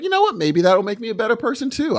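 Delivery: high inner voice. Retold or imitated. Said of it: "you're like".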